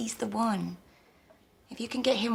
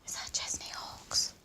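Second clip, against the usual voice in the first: hushed, voiceless whisper; whispered aside; 7 dB quieter than usual. Acted. Whispering about a possible celebrity nearby, hushed aside. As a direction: whisper it discreetly.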